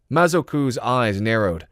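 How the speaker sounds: ordinary delivery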